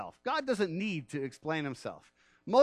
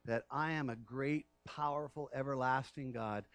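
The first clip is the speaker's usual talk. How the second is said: low, soft, gentle divine reply; low gentle voice of God to Stan; calm voice of God; 5 semitones lower, 5 dB quieter than usual. Acted. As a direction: speak as God, quietly assuring.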